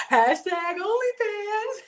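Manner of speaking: high, loud, slow laughing cry; tearful-laughing shriek. Real, not acted. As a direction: wail it through laughter. Crying-laughing joke.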